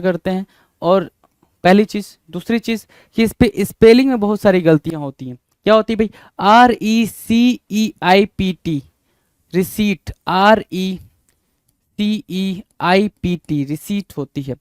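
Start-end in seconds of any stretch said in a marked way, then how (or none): none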